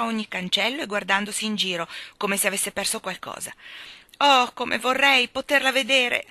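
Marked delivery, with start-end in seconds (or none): 4.2-6.3 wistful wail, high and slow; long for it aloud, drawn out; sad. A narrator's reading. Jane stuck in bed wishing to see, sad longing. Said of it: "disse triste Jane".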